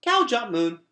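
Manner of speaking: put-on high, loud, plain little-child statement; matter-of-fact toddler. A narrator's reading. Boots telling her brother the nursery rhyme, high and sure. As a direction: state it like a certain two-year-old. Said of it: "she said matter-of-factly".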